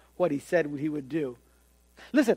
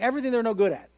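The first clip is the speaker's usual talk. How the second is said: ordinary delivery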